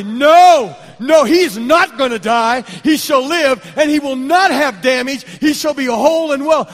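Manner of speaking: loud, high shouted proclamation; faith declaration. Retold or imitated. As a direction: declare it like a prophetic command.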